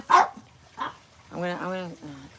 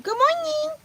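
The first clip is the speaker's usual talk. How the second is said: high singsong greeting. Acted.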